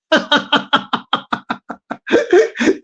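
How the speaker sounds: rapid, pulsing laugh rising at the end; helpless run of short chuckles; staccato chuckle. Real, not acted. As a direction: laugh at yourself in a long rattle. Laughing at talking to himself.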